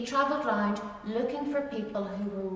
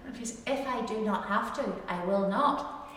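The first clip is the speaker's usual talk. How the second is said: ordinary talk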